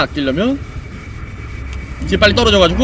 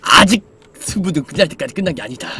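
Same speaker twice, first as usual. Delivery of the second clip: famous quote voice; 6 semitones lower than usual. Retold or imitated. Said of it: "못 들어보셨습니까".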